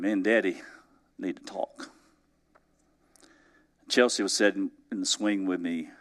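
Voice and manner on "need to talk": hushed. Real, not acted. Confessing need to talk to God.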